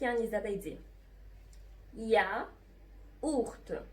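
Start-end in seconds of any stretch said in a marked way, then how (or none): 1.9-3.8 very slow "ياورت", split "ya... ourt"; teacher's drawn-out model of "ياورت"; syllable by syllable. Shown as a demonstration. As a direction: break "ياورت" into two long pieces.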